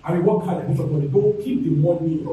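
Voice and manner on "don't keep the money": ordinary delivery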